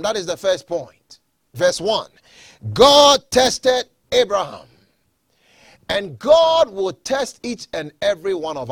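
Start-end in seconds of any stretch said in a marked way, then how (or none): 2.7-4.7 slow emphatic repeat. Acted. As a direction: drive the point home slowly. Repeats point slower.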